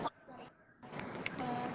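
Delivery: very faint breathy murmur; barely there, far off; barely audible. Real, not acted. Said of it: "suaranya kecil banget".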